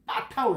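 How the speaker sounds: 빠타흐 with a tense, forceful opening B; 빠타흐 begun on a doubled, unaspirated 빠; hard first consonant. Shown as a demonstration. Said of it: "이렇게 해야 됩니다".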